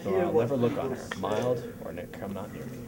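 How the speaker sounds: grand theatrical Shakespeare delivery; hammy stage actor. Acted. Reciting Shakespeare lines in rehearsal.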